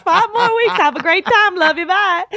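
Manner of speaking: high, loud sarcastic sing-song; sarcastic farewell. Retold or imitated. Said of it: "I'm looking at you like".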